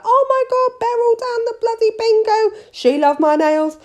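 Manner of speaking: high, thrilled, breathless rave; gushing client. Retold or imitated. Hooked client raving about her nails at bingo, high and thrilled. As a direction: rave about your nails to the whole town. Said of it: "they'd be like".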